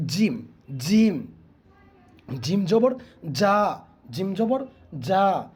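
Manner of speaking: জিম said firmly and slowly; hard jim. Shown as a demonstration. Teacher shows a hard জিম to students. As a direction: stress the hard j in জিম, slow. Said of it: "জিমটা শক্ত করে".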